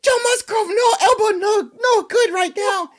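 high squeaky puppet voice, crying out; whiny little monster in pain; squeaky Elmo wailing about an elbow; Elmo impression. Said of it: "Your impressions get me every".

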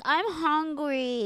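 put-on high, slow whiny little kid; imitating the bad kid asking for food; whiny toddler. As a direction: whine it like a spoiled toddler. Toddler spits food then demands more.

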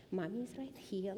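ordinary talk, nothing marked

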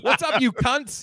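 high, drawn-out crowd greeting; mock comic's greeting. Comic heard blocks away greeting the crowd, mock hollering. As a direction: call it out for laughs, as if echoing down the street.

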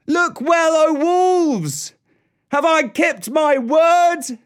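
high, loud triumphant shout; shouting triumphantly. Acted. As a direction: yell it out in triumph. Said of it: "he shouted it aloud".

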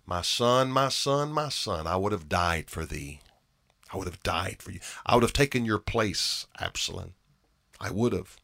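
hushed, low lament of a bereaved father; quiet, heavy regret for a lost son; grieving father's lament. Acted. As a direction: mourn softly, voice sunk low. David mourns slain son.

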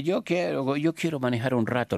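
put-on high, insistent Argentine-accented pope. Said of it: "así con el acento argentino".

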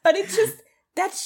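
laughing. Retold or imitated. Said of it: "And I was like".

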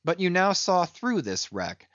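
usual speaking voice

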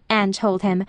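usual speaking voice

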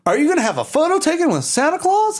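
high, fast carnival barker pitch; hustler sales pitch. Retold or imitated. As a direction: sell the Santa photo like a pushy barker. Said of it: "being like".

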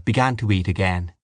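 ordinary delivery, nothing marked

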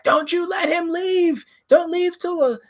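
high, frantic mock yell; frantic mother shouting over the phone; urgent high-pitched yell; mom yelling. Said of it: "my mom was like".

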